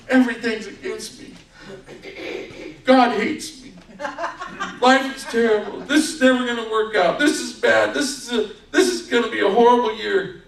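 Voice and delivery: high, whiny mock complaint; mock despair. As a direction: imitate someone declaring that all is doomed. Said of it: "looking with your perspective at something and go".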